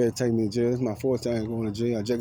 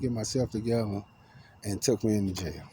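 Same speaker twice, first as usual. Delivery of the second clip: usual speaking voice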